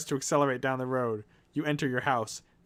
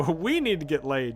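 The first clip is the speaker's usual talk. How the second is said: mock scream; 5 semitones higher, 5 dB louder than usual. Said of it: "you scream".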